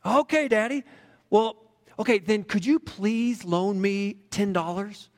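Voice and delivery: put-on a kid asking for a favour he hopes to get; hopeful little boy. A narrator's reading. Boy asks dad for loan.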